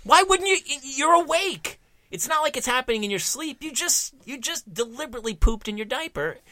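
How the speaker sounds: high-pitched, incredulous mock scolding; exasperated parent. Mother questioning daughter about the diaper, exasperated. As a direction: plead in a high, baffled voice.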